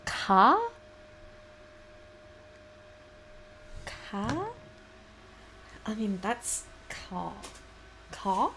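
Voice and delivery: trying out a regional "Car"; Pennsylvanian pronunciation. Shown as a demonstration. Puzzling over Pennsylvania's way of talking, regional pronunciation. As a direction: draw out "Car" like a puzzled imitation of Pennsylvania speech. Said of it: "What a fucking way to pronounce it".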